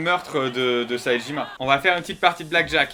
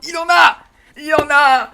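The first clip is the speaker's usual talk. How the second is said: excited; 9 semitones higher, 6 dB louder than usual. Real, not acted. Finds karaoke songs.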